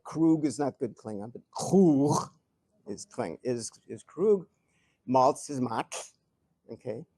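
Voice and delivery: ordinary talk, nothing marked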